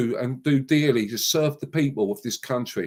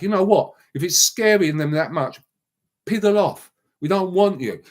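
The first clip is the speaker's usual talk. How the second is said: angry outburst; 7 semitones higher, 3 dB louder than usual. Real, not acted. Angry rant at flag objectors.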